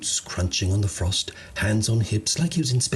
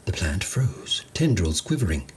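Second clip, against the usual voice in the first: usual speaking voice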